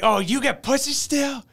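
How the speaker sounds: hammed-up celebrity video message. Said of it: "and then he's like".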